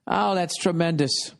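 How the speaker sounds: normal talking voice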